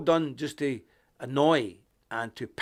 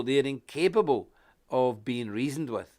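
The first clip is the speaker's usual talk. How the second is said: ordinary delivery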